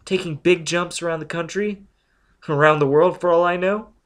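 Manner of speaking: outraged accusation; accusing. Acted. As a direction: pin him with each charge. Robin accuses Foyle.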